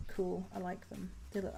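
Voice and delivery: ordinary talk, nothing marked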